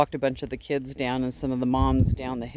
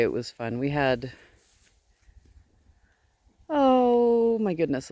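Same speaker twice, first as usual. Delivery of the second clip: normal talking voice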